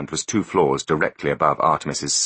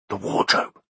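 unvoiced whisper of the find. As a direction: breathe it out as a whisper. First whispered take.

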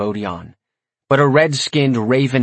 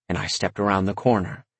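plain reading voice

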